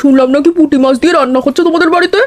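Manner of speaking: put-on a cheerful older neighbour dropping by; aunt's voice. Neighbour aunt visits the family.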